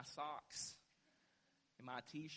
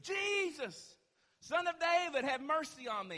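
high, loud desperate cry, falling away at the end; undignified shriek; 10 semitones higher, 9 dB louder than usual. Acted. Blind man begs Jesus for mercy, shrieking out. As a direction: cry out with no care for dignity. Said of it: "began to call out and shriek".

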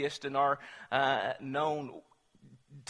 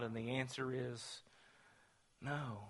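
hushed answer; 5 semitones lower, 15 dB quieter than usual. Acted. Preacher answering his own question, hushed. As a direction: drop to a near whisper for the final no.

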